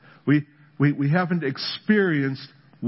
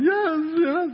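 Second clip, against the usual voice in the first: much higher, ecstatic cries of yes; ecstatic worshipper. Retold or imitated. Crying conference crowd in rapture, high ecstatic yes. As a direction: cry out like a moved believer. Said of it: "People are crying, going".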